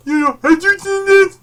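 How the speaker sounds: high, loud squeaky mock voice; mock Facebook poster. Vlogger mocking Facebook posters in a high squeal.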